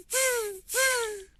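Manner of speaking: very high squeaky tz tz tz; monkey chatter. Acted. Monkeys mocking the peddler, high squeaky chatter. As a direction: chatter back like cheeky monkeys.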